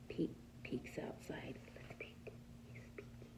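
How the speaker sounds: soft whispered storytelling; suspense whisper. A narrator's reading. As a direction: whisper it like a secret to the kids. Suspenseful bedtime story narration.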